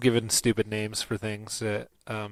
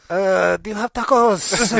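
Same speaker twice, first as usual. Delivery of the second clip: slow, higher dopey customer voice; silly customer; 7 semitones higher, slower than usual. Retold or imitated. Joking about asking for tacos at the wrong place, dopey slow customer. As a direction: play it dumb and drawn out.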